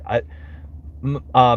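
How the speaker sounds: normal talking voice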